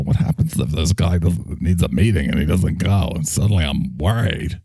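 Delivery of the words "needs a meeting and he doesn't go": ordinary delivery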